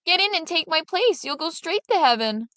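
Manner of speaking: high, lively coaxing; Little Claus talking the drover into the sack, high and eager; eager urging. A narrator's reading. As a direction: hurry him in with eager excitement.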